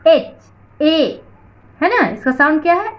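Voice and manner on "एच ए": higher "एच", then its first vowel "ए" alone; letter-sound demo. Shown as a demonstration. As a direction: split "ए" off from "एच". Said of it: "क्योंकि इसका sound है". ("एच" से "ए" अलग करके सुनाना)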